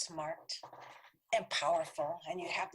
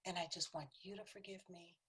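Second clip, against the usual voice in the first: usual speaking voice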